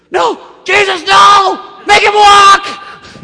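high, very loud, drawn-out yell; shouted protest. Acted. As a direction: holler down in exasperation. Roof friends shout down in protest.

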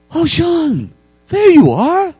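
put-on high, loud, overjoyed old priest; exaggerated high delighted welcome; gushing priest. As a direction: greet him like a long-lost son. Priest greets returned thief.

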